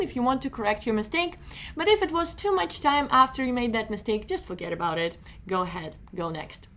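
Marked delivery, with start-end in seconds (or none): none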